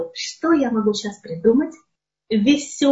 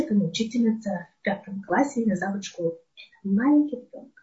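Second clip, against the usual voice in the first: ordinary delivery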